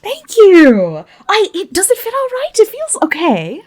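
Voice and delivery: a high, bashful Christine voice; nervous and pleased, slightly rushed; shy Christine. Acted. Plays Christine in character.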